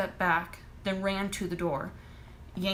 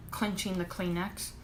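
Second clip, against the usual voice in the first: ordinary delivery, nothing marked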